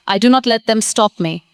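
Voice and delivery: normal talking voice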